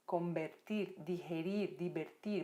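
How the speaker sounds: ordinary delivery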